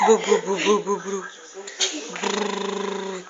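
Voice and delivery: put-on lip buzz for the baby; low playful bug buzz; buzzing bug sound. Shown as a demonstration. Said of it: "Faz besourinho".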